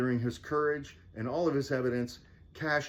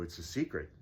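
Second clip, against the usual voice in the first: softer, lower secretive aside; secretive hush; 6 semitones lower, 7 dB quieter than usual. Acted. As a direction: murmur it like a secret. Knight says secret.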